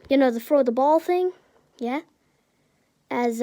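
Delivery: ordinary delivery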